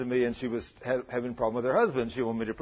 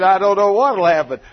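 laughing loudly; 4 semitones higher, 12 dB louder than usual. Retold or imitated. Woman laughing retelling her reaction.

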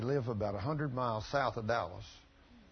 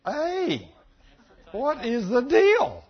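exclaimed; 7 semitones higher, 11 dB louder than usual. Acted. Preacher answers a doubter, exclaiming.

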